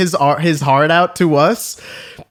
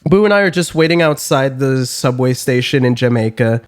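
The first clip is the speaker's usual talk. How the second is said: usual speaking voice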